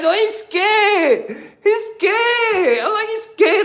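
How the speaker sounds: very high, drawn-out squeal; gleeful realization. Retold or imitated. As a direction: squeal it in high-pitched amusement. Gleeful thoughts about the scared cellmate.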